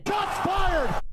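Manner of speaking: high hammed-up shout; shots fired shout. Streamer reacts to a roast with a high comic shout. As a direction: yell it like a comic announcer.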